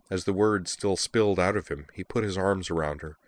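ordinary delivery, nothing marked

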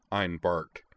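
ordinary delivery

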